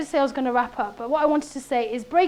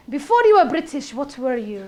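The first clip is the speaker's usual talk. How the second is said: exasperated officer; 8 dB louder than usual. Retold or imitated. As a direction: press like a fed-up interrogator. Acted exasperated officer pressing her.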